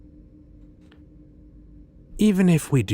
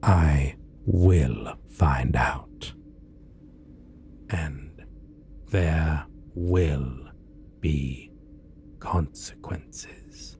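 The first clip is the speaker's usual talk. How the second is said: menacing whisper; 2 semitones lower, much slower than usual. Acted. Villain threatening the job applicant in a slow whisper. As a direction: let the threat hang, barely voiced.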